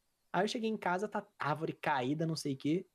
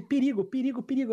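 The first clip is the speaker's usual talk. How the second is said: higher, louder alarmed repeating; neighbor's warning; 7 semitones higher, 4 dB louder than usual. Retold or imitated. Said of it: "ele começa a repetir". (vizinho avisando o perigo, mais agudo e alto)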